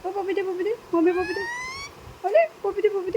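small, high, doting voice; coaxing pet talk. Real, not acted. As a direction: coax a kiss from a pet.

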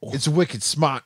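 put-on drawn-out Boston accent. Riffing on Wicked for co-host, Boston accent. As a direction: say it like a Bostonian, slowly. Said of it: "so good at a Boston accent".

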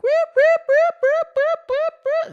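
very high, loud whooping siren; imitated alarm. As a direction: mimic the relentless alarm, shrill and loud. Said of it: "it's just like".

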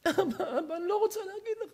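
high, soft pleading; small, quick, high acted plea; reluctant daughter. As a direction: hold back bad news from father. Daughter reluctant to tell bad news.

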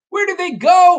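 high, loud storybook cry; bewildered character shouting high; alarmed question. Acted. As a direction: call out in hammed-up alarm. Waffle alarmed through the smoke.

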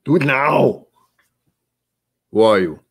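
loud Austrian action-hero bark; Arnold Schwarzenegger. Acted. Host slipping from Scottish, barking movie-star impression. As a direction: shout it in a put-on Austrian accent. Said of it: "slipping into Arnold Schwarzenegger".

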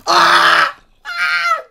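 high yelps of being hit; pained yelps. Real, not acted. Gamer shot by a skeleton, yelping. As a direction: yelp at each arrow.

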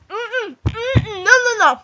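high whimpering no. Real, not acted. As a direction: whine it out in pain. Mouth burning, protesting.

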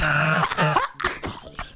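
loud helpless laugh; burst of laughter. Real, not acted. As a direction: crack up after your own joke. Laughs at his own imitation.

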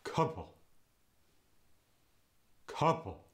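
"Couple." with a vowel between uh and ah; Yiddish couple. Shown as a demonstration. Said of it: "goes to".

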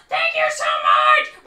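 high, loud burst of delight; excited thanks. Acted. Kyle receives the present from teacher, excited shout. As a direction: shout your thanks, thrilled. Puppet handed his present.

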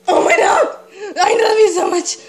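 loud, high, near tears with joy; ecstatic squeal. Real, not acted. Collector gushing to viewers over her last toy. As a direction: cry it out in delight. Best figure saved for last.

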